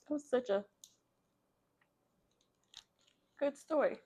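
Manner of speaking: moved by the story, voice slipping away; emotional. Real, not acted. Host just finished reading a sad story, real emotion in the voice. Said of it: "Got me a little emotional".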